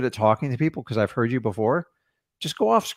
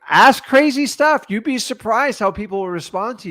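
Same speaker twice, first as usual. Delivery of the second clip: excited squeal; 8 semitones higher, 5 dB louder than usual. Real, not acted. Sudden excited outburst.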